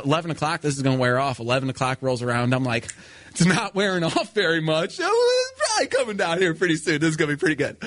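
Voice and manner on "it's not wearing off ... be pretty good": squeaky and high, giggling; high tripping voice. Retold or imitated. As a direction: voice climbing up high.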